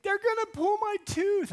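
scared daughter. Retold or imitated. Daughter telling dad her fear, whimpering child voice. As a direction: whine it like a frightened child. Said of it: "She's like".

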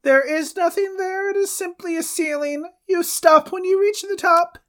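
very high, put-on old-world voice; medieval peasant voice. Man from the past questions a stranger, very high put-on voice. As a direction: squeak it like a frightened peasant from the past. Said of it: "I'm the one that has to do the accent".

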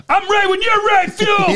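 very high, eager mock shout; imitated fan yell. Superfan pocketing glasses to join in, eager yell. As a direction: holler like a fan ready to fight. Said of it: "he kept yelling it".

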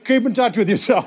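lightly voiced dad ending a call; dad's sign-off, acted out. Dad ending call with a senator, lightly voiced. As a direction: act out the dad's chummy phone farewell. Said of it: "he's like".

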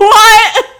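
high, loud laughing yell; hammed-up shriek of disbelief; shocked. Podcast host reacting to the dog's death, mock shocked.

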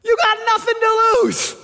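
high, loud exclamation; loud preaching outburst; emphatic shout. Real, not acted. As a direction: shout the point home to the crowd.

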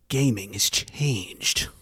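low gravelly whisper, slow; Snake impression. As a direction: Solid Snake rasp, slow and hushed. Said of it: "That was not a very good Snake impression".

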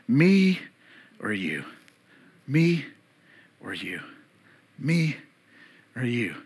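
back and forth. Retold or imitated. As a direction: toss "Me" up, let "you" fall away low. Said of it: "ball's going back and forth in Paul's mind".

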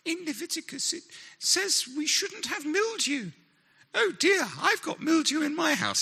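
very high, fluttery impression of Pippa; Pippa's funny voice. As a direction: squeak it out like a fussy lady. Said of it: "she says these funny little things like".

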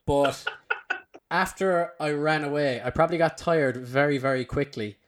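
ordinary talk

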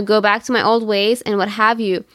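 ordinary talk, nothing marked

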